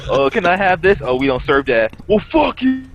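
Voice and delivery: high, loud fussy customer voice; mock customer. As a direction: squeak out a dithering caller. Imitating phone customers.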